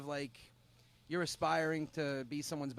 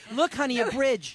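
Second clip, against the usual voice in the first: mock scheming husband; 8 semitones higher, 10 dB louder than usual. Joking voice of bride's fiance.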